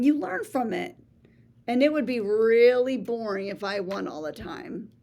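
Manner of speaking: ordinary delivery, nothing marked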